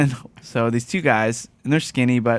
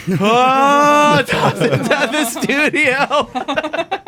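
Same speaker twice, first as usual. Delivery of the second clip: high, slow words through laughter; laughing; 13 semitones higher, 3 dB louder, much slower than usual. Real, not acted. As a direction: laugh it out in disbelief. Laughing at own mix-up.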